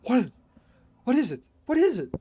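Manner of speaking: frantic narrator calling down the phone; terrified questions. Acted. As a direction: demand an answer in terror. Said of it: "Terrified, I continued to repeat".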